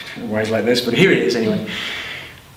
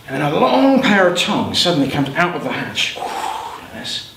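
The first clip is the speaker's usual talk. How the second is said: ordinary delivery, nothing marked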